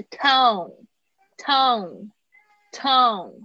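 tone, tone, tone slow, full O glide with a nasal ending. Shown as a demonstration. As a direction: stretch out tone with a full O and nasal end. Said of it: "加上鼻音". (慢慢念tone，双元音加鼻音)

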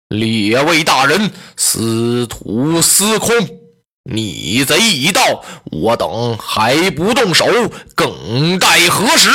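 low, slow and solemn call to strike; a stately old minister giving the signal; commanding minister. Acted. Wang Yun calls the officials to kill the traitor, low and solemn.

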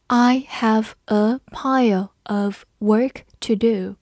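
slow, every word on its own; slow read-along. Shown as a demonstration. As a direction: model it slowly for learners. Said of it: "跟着我慢读一遍". (放慢语速，逐词领读)

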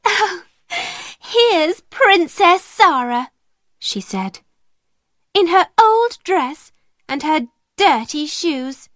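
high, sneering sing-song taunt; mock her with fake sweetness. Spoiled schoolgirl taunting Sarah on the stairs, high and mocking. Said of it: "gave a little laugh".

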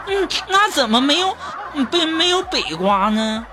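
high innocent question; naive coworker. Retold or imitated. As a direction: ask in a wide-eyed girlish voice. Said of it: "当时锦瑶就问我". (同事天真地高声发问)

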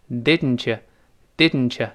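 "didn't you" reduced to didn-cha; weakened you. Shown as a demonstration. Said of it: "那个you又会弱化成呀".

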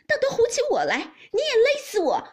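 fast, high hysterical shouting; screaming accusation. A narrator's reading. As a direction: scream at them as the wronged wife. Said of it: "叫道". (尖声哭闹叫喊)

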